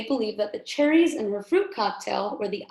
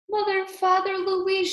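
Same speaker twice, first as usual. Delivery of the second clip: high tearful calling of names; crying out; 8 semitones higher than usual. A narrator's reading. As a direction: cry out for family, mildly weepy. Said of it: "she cried".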